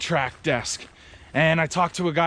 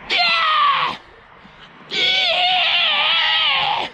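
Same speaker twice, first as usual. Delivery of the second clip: put-on high, loud childlike yell, much higher; imitating a screaming child; 4 dB louder than usual. Next-door child heard through the wall, high screaming yell. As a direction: mimic a little kid screaming nonstop. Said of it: "he's screaming at the top of his lungs".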